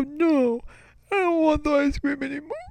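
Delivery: put-on very high, tearful kid whine; mock sobbing, high-pitched; imitated crying. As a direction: wail the refusal like a sobbing child. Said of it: "it made me cry even more".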